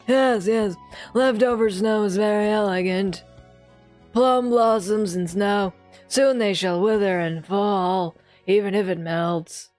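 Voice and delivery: lightly voiced old teacher; old man voice. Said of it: "he's still getting the old man voice".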